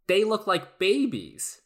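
put-on kid voice from ad. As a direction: read it like a kid in an ad. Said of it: "the very first line of the commercial is".

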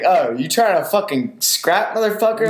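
hammed-up tough guy picking a fight; mock tough challenge. Retelling squaring up at bar.

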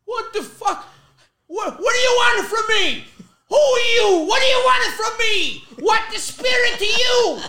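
very high, loud mock-panicked shouting; terrified dad. Son imitates dad talking to the dead, panicked yelling. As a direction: shriek at the ghost like a spooked father. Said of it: "He jumps up and goes".

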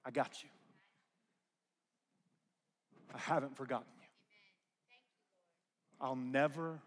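soft, hushed voice of comfort; tender reassurance. Acted. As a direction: speak gently as God reassuring. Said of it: "your wonderful counselor says".

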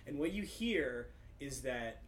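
ordinary talk

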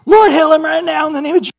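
high, loud, fast shouted prayer; mock Pentecostal prayer, shouted high and fast; fiery Pentecostal prayer. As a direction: call down healing like a revival preacher. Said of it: "I go to the Pentecostals".

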